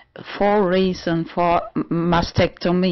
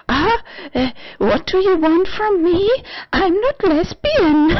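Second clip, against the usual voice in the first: much higher and louder, playfully indignant; high mock protest; 13 semitones higher, 4 dB louder than usual. Imagining telling mom, high mock protest. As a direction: squeal the denial in a high put-on voice. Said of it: "I imagine myself already, uh, telling her".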